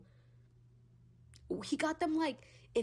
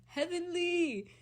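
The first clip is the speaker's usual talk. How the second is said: high, slow, dreamy swoon; drawn-out high swoon; 10 semitones higher, 3 dB louder, slower than usual. Real, not acted. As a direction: sigh it out in adoration. Swooning over haircut.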